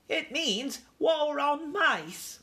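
high, drawn-out dire warning; ominous aunt mouse, stretching each word. A narrator's reading. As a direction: pronounce doom slowly in a high voice. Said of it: "said Aunt Dorothy ominously".

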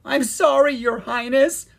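high, loud wail of apology; acted bursting into tears, lightly done; tearful apology. A narrator's reading. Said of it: "bursting into tears".

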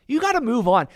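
ordinary talk

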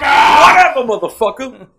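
high, loud yelled quote; shouted movie quote. Retold or imitated. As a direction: scream it back like the movie line.

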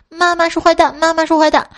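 high-pitched mock kid protest; frantic child yelling. Son refusing bitter medicine from mum, frantic high yelling. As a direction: cry out like a panicked three-year-old. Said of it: "他急了一直喊".

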